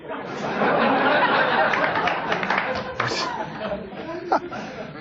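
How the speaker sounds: real helpless giggling, high and breathless; long laughing fit. Real, not acted. Host breaking up laughing mid-segment, real long laugh. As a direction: lose it completely for a few seconds.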